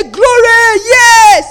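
loud, high cry of praise; triumphant shout. Acted. Preacher rousing the congregation over the Bible verse, triumphant shout. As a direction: shout it out in exultation.

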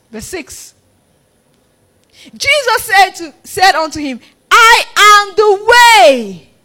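loud, high, drawn-out scripture proclamation; full-force preaching. Real, not acted. Preacher declaring the way to the Father, full-force. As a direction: proclaim each phrase at full volume.

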